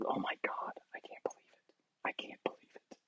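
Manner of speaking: soft, airy whispered disbelief; stunned whisper. Real, not acted. Gamer whispering to himself in disbelief. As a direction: whisper it like you can't breathe.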